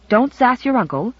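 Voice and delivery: ordinary delivery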